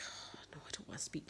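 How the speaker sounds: whispered, wanting to stay unseen; panicked whisper. Retold or imitated. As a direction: hush it as if ducking out of sight. Hiding from acquaintances at the stables.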